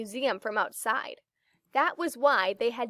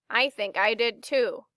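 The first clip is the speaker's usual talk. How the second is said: plain reading voice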